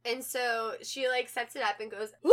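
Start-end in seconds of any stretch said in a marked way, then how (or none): 2.2-2.3 sudden loud fake wail; mock wailing cry. Girlfriend setting up her prank, loud fake wail. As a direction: burst out in a hammed-up "Wah". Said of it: "fake crying and freaking out".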